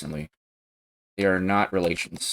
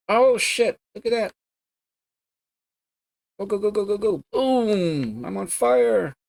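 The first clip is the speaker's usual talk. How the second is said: much higher, excited cheering; hyped gamer; 13 semitones higher than usual. Real, not acted. Streamer cheers his own racing run, excited and high. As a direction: cheer yourself on through the race. Racing game live reactions.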